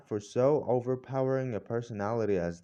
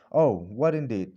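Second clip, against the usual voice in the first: ordinary delivery, nothing marked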